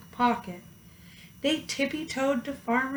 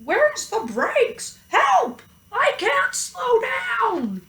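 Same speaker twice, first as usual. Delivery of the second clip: very high, loud panic; panicking cow; 12 semitones higher, 5 dB louder, slower than usual. A narrator's reading. Cow at the wheel panicking, very high. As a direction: cry for help with no brakes.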